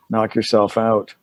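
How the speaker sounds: slow, unimpressed retort; dry dismissal. Retold or imitated. Assistant director humors actor hiding a sandwich, dry dismissal.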